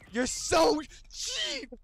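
"cheap" drawn out high and whiny; whiny complaint. Real, not acted. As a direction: whine the word "cheap" slowly. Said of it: "repeated complaint".

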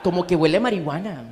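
lightly voiced chatty old lady; a hint of a gossiping little old woman, laughing; old lady sniffing the street air. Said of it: "como esas viejitas que van caminando".

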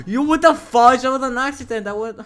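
high, loud protest; indignant shout. Real, not acted. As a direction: yell it as an outraged excuse. Protests being hit back.

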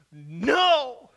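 loud, high cry of no. Acted. Desperate woman in the crowd, loud cry. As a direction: let the cry burst out. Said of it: "utters an audible and loud".

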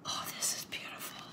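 hushed, quick whisper of wonder; awed whisper. Real, not acted. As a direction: whisper it, deeply moved. Moved by the gift after gasp.

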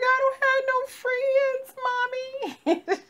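put-on a sad child complaining to her mom; little girl telling mommy she has no friends. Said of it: "I was like".

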